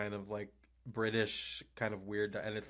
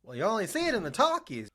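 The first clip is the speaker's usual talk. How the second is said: put-on loud, high old-Hollywood voice; transatlantic accent; 11 semitones higher, 7 dB louder than usual. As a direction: deliver it like a 1930s film star. Mock old-movie line.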